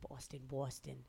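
put-on muttered Boston accent warm-up, very soft; low, quiet practice Boston accent. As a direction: rehearse a Boston accent under your breath. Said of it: "Do your Boston accent".

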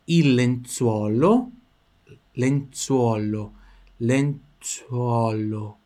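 lenzuolo drawn out, lips rounding on U and O; lenzuolo very slowly. Shown as a demonstration. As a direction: mouth every vowel of lenzuolo. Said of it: "Tá vendo o movimento que faz?". (lenzuolo bem devagar, mexendo a boca no U e no O)

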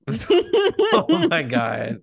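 laughing loudly. Real, not acted. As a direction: crack up while talking. Laughing hard at joke.

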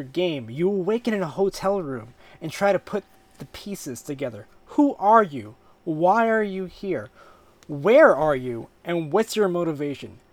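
narrating a game trailer; dramatic blurb reading. Acted. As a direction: read it like a trailer. Reads the game description.

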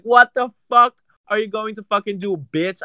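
reenacting a parking lot fight, furious yell; retold yelling. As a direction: yell it at her man like you're ready to fight. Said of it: "and I was like".

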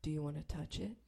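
low, soft invitation; imitated hushed come-on; creepy proposition. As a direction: murmur it as a sleazy offer.

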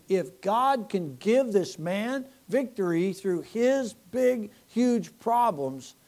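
slow, stretched "big, huge problems"; emphatic slow. Real, not acted.

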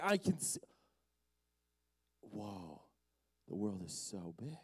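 the healed man, thrown out, marvelling quietly at the big world; awed wonder. Acted. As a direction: marvel at seeing the world for the first time. Blind man amazed at seeing.

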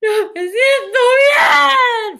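alarido lloroso imitado, lentísimo y agudo; llanto a gritos. Retold or imitated. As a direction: berrear exagerando cada palabra. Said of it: "esa huevona lloró así". (llanto gritado, agudo y teatral)

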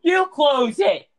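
high, loud, slow command; loud shout. Real, not acted. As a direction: bark the order at the door. Yelling at someone interrupting recording.

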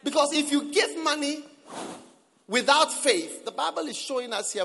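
normal talking voice

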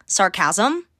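"Sarcasm" as sar-CAS-um, rising at the end; misplaced syllable stress. Shown as a demonstration. Podcaster showing the joke word, "Sarcasm" stressed as sar-CAS-um. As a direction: hit the second syllable of "Sarcasm". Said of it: "Putting the wrong emphasis on the wrong syllable".

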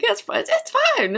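high, easygoing reassurance; imitating Carrie. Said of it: "He was like".

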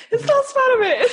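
shrieking with laughter, voice cracking; laugh-crying through the words; hysterical laugh. Real, not acted. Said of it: "Why are you laughing like that?".

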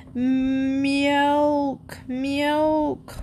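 high drawn-out meowing whine; whining cat. Acted. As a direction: meow it out pleading. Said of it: "whined Lightfoot".

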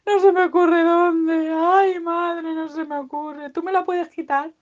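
high, loud helpless wail; hammed-up whiny despair; sad piglet. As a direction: moan it like a helpless little creature. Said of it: "tan triste".

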